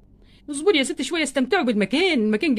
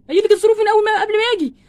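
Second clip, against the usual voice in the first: higher and louder, a frustrated yell; angry outburst; 7 semitones higher, 5 dB louder than usual. Acted. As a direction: blow up at her for not listening. Ken protesting about the money. (الزوج يصرخ في زوجته بغضب وإحباط)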